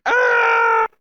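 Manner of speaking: high, held mock shriek; imitated scream. Guy in the web facing the spider, high imitated scream. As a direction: scream it high and long, play-acting. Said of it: "he's like".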